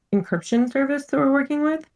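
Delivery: ordinary delivery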